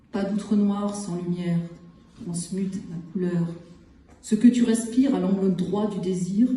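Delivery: measured poem recital with long pauses between phrases; poetry reading. Acted. Poet reads short poems from the collection to listeners, measured recital. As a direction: set each short phrase apart, letting silence follow.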